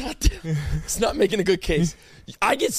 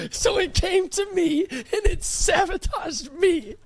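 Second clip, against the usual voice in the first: much higher, whiny protest; exaggerated outrage; 9 semitones higher than usual. Real, not acted. Co-host protesting he took the sickness, much higher whine. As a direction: play up being wronged by the virus. Mock outrage at being sabotaged.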